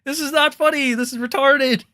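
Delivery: annoyed outburst; heated rebuttal. Real, not acted. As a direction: insist it's not funny. Angry rebuttal.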